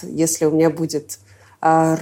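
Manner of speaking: usual speaking voice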